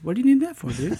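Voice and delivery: high, spaced-out, earnest question; Donny impression. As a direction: innocent and puzzled, pitched high. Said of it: "he just goes".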